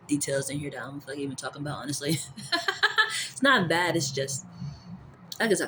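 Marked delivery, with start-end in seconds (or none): 2.2-3.3 high laugh. Real, not acted. Sudden burst of laughter.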